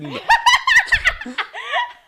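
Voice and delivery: high, loud shrieking laugh; loud burst of laughter. Real, not acted. Co-host hearing an absurd fanfic twist, shrieking laugh. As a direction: explode into a shout of laughter.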